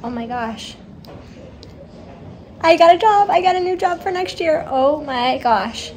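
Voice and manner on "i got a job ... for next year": higher, beaming announcement; real excitement. Real, not acted. Telling viewers she got a new job, thrilled. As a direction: savour the news with delight. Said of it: "I'm so happy and I'm so excited".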